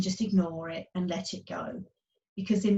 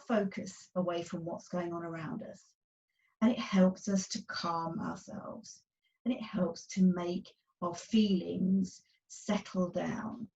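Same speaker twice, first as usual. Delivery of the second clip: soft calming voice; 5 dB quieter than usual. Acted.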